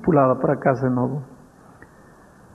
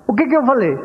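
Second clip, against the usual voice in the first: stern mother; 8 semitones higher, faster than usual. Retold or imitated. Mother demanding repetition.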